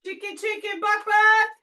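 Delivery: high clucking call to a chicken; playful chicken call. Pretend. Calling the chicken.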